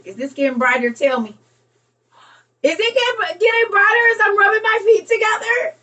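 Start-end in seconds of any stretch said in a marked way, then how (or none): 2.6-5.8 high, loud thrilled question; high amazed disbelief; excited. Real, not acted. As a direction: ask it bursting with excitement. Thrilled at the light bulb.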